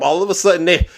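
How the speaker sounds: usual speaking voice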